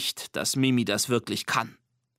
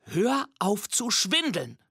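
stern; 7 semitones higher, slower than usual. A narrator's reading. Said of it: "sagt Nina streng".

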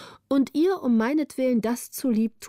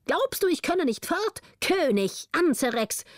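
high, taunting retort; defiant villain calling; 8 semitones higher than usual. A narrator's reading. Cornered governor defying the king, calling out high. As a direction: taunt the king in a raised pitch. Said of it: "rief Pecavus".

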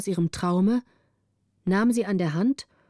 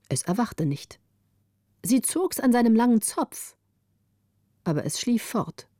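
plain reading voice

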